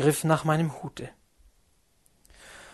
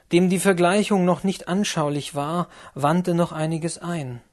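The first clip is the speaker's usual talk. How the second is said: plain reading voice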